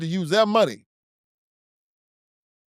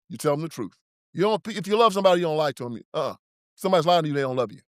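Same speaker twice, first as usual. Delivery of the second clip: normal talking voice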